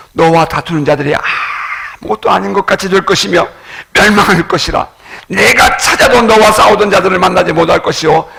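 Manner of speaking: loud, building preaching voice; scripture proclamation. Acted. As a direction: proclaim the Lord's word with force. (힘차게 말씀을 선포하는 설교)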